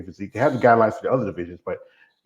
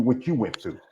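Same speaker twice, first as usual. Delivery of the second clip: normal talking voice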